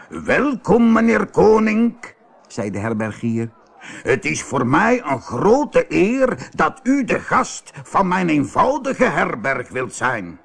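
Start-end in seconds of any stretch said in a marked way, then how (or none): 0.1-2.1 high, loud, drawn-out welcome; effusive innkeeper. A narrator's reading. Innkeeper welcomes the rich merchant, effusively. 4.0-10.4 slow, higher-pitched fawning host; deferential innkeeper. A narrator's reading. Innkeeper flatters the rich merchant, deferentially.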